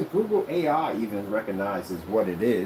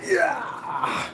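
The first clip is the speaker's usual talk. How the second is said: triumphant yell. Acted. Imitates screen bursting out, triumphant yell.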